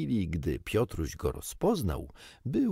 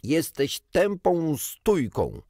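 slow, higher and louder shout; angry yell; 8 semitones higher, 5 dB louder, slower than usual. A narrator's reading. Irritated bird yelling at Peter, slow and loud. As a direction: yell the insult in frustration. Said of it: "wrzasnął".

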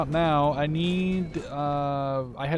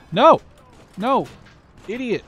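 frustrated high yell at crew; angry yelling; 9 semitones higher, 8 dB louder than usual. Real, not acted. Tank missed, yelling at crew.

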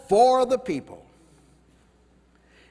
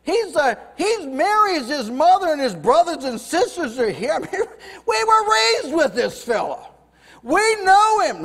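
mocking townspeople; 7 semitones higher than usual. Preacher mocking people dismissing the prophet, high sneering voice. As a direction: scoff like neighbours who grew up with him.

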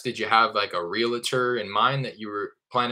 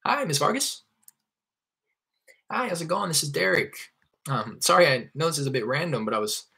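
much higher, bright and chirpy hello; friendly cold call greeting; 6 semitones higher than usual. Shown as a demonstration. Caller Derek greeting owner Miss Vargas, bright and chirpy. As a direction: greet her like an old friend. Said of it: "my intro".

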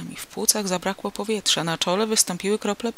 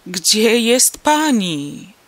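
very loud, very slow despairing shout; desperate cry; 4 semitones higher, 9 dB louder, much slower than usual. A narrator's reading. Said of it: "krzyknął rozpaczliwie". (rozpaczliwy, przeciągły krzyk)

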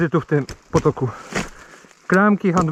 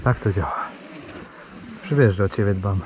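tender; 10 semitones lower, 6 dB quieter than usual. Pretend. Talking tenderly to his motorbike.

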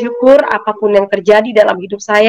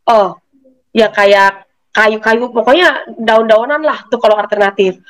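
ordinary talk

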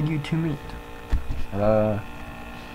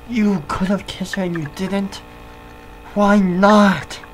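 indignant; 8 semitones higher, 5 dB louder than usual. Acted. Confronting over the missed kiss.

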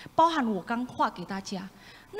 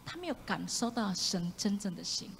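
normal talking voice

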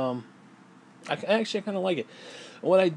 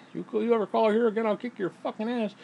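higher, mock macho threat; lightly hammed-up threat; 7 semitones higher than usual. The original threat in the old chapter, lightly hammed. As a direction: bark it like a tough guy, half joking. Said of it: "instead of saying".

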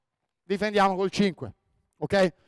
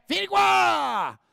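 calling out; 10 semitones higher, much slower than usual. Real, not acted. Coach calls player over. (richiamo gridato a voce alta)

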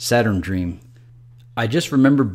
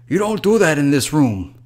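raised, louder scolding voice; 6 semitones higher, 5 dB louder than usual. Acted. Man in a robe telling off the intruder, scolding. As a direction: tell him off firmly.

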